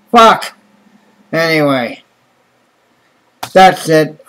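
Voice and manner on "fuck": loud, higher exasperated swear; angry curse. Real, not acted. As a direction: bark the word out in disgust. Ranting after no shit.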